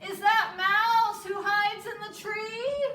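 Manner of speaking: loud, high call into the tree. Acted. Bear calling up to Mouse in the tree, loud. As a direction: call up to the treetop. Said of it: "Bear calls".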